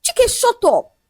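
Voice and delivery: high-pitched angry outburst; shrieked order, moderate volume; screamed command. A narrator's reading. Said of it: "Ola screamed".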